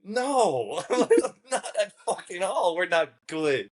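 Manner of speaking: high-pitched emphatic no; incredulous denial. Retold or imitated. Contestant answering the hopeful girl, high incredulous denial. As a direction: deny it in high disbelief.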